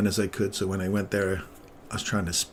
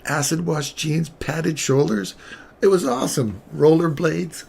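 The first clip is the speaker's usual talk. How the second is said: higher and louder, gushing over each item; enthusiastic; 7 semitones higher, 6 dB louder than usual. Retold or imitated. Husband gushing to wife about eighties fashion, excited. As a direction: sell the eighties like a fan.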